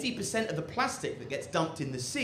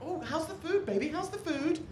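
much higher, sweet fussing voice; doting partner; 4 dB quieter than usual. Acted. The cook's partner greeting them home, high and doting. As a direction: coo over them like a loving partner. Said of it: "they get back home from a hard day".

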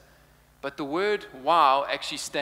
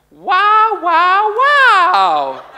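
much higher and much louder, a sung-out announcer "wow"; shrill intercom announcer; 14 dB louder, much slower than usual. Retold or imitated. Preacher imitates the supermarket intercom voice, high and loud. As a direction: belt it like the store's intercom lady. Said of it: "you would hear this voice, and it goes".

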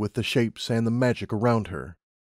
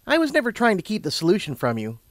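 higher, explaining character voice; lightly acted reassurance; lightly voiced Twilight; 9 semitones higher, faster than usual. A narrator's reading. Twilight explaining herself to Lumina, sympathetic.

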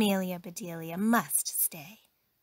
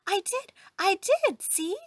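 high, bright-eyed eager insistence; eager reply; 12 semitones higher, 6 dB louder than usual. A narrator's reading. Maid shows employer her picture, eager and high. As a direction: insist proudly, pitched well up.

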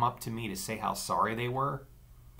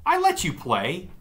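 defensive; 9 semitones higher, 6 dB louder than usual. A narrator's reading. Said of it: "I defended myself".

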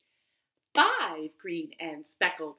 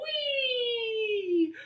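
swooping high whee; high squeal. Pretend. Teacher squeals as frog jumps into pool.

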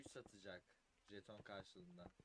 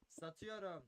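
auctioneer; 5 semitones higher, slower than usual. Real, not acted. Auction going twice call.

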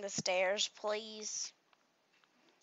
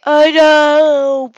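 much louder, annoyed I know; loud retort; 18 dB louder than usual. Real, not acted. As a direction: fire it back at mom. Snapping back at mom.

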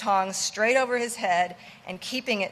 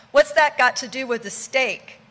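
testy; 4 semitones higher, 7 dB louder than usual. A narrator's reading.

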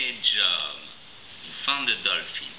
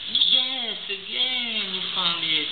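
excited praise; 9 semitones higher, slower than usual. Real, not acted. Praising toddler again.

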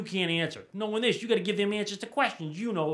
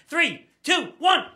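loud, high millennium countdown; acted-out New Year's countdown; countdown shout; 10 semitones higher, 6 dB louder, slower than usual. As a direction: count down the new year like the world may end.

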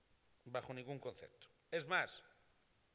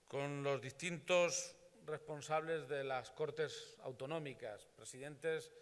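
ordinary delivery

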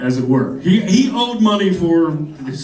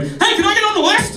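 high, wide-eyed eager voice; eager open mic guy. Retold or imitated. Comic imitates wide-eyed guy asking for stage spot, high and eager.

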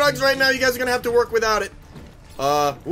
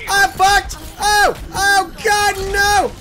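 panicked yell; 9 semitones higher, 5 dB louder, much slower than usual. Real, not acted. Dying in a fight.

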